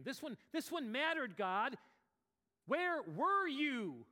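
loud, high, stretched accusation; anguished cry. Retold or imitated. Pastor cries out to God, loud slow anguish. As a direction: hurl the question slowly at God.

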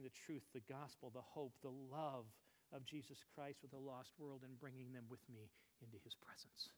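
soft unvoiced whisper; whispered devotion. Real, not acted. Preacher closes gently, whispered devotion. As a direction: drop to an intimate whisper. Soft closing of sermon point.

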